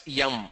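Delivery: "yum" for M, y glide in front; M with an added y at the start; South Indian letter M. Shown as a demonstration. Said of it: "But in South India, everybody pronounces this as".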